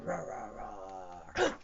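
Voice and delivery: slow, low rumbling roars; low dinosaur growl. Acted. Low roars before the challenge.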